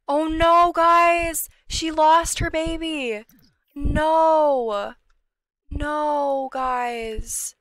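loud, high anguished outcry; distraught cry. Real, not acted. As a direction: cry out in real dismay. Game baby just lost.

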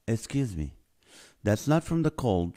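ordinary delivery

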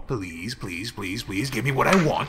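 loud, fast insistent please; demanding plea. Acted. Begging building to demand.

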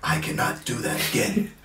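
hushed, voiceless villain impression; Thanos. Likening the rapper to a film villain, hushed impression. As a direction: intone it softly like a weary supervillain. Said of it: "he's Thanos ... It's inevitable".

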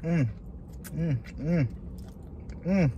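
chewing while humming; savoring food with closed lips; mouth full. Real, not acted. Seen 0: Closed mouth moving, hand at lips, licks fingers while humming.

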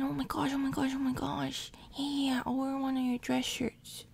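ordinary talk

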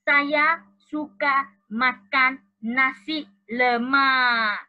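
very slow Malay sentence for the class; slow clear reading. Shown as a demonstration. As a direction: stretch each word for beginners. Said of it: "第五是".

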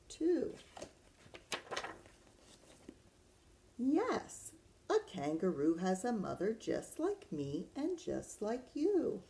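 lilting picture-book read, questions rising high at the end; reading to children. Acted. Teacher reads Does a Kangaroo Have a Mother Too, lilting storytime voice. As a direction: read it to little ones, swooping the pitch.

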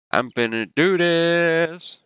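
high, drawn-out hype; psyched up. Real, not acted. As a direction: stretch it out high, psyching yourself up. Psyching self up before the challenge.

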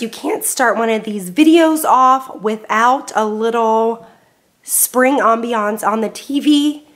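ordinary talk

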